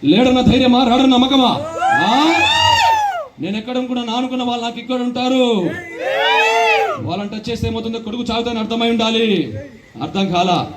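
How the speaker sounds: swagger through each line like a mass hero; Babai's mass punch dialogue. Acted. The uncle breaks up the fight, with a mass hero swagger. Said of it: "అప్పుడు అంటాడు".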